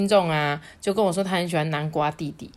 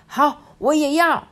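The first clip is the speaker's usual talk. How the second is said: high, loud, me-too enthusiasm; eager; 10 semitones higher, 6 dB louder than usual. Acted. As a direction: jump in eagerly as a little storybook child. Pumpkin joins the race.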